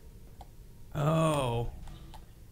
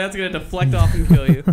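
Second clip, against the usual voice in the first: loud warning; 3 semitones higher, 10 dB louder than usual. Retold or imitated. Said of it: "I was like".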